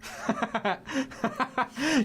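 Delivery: high burst of laughter; laughing. Real, not acted. Player laughing at the story.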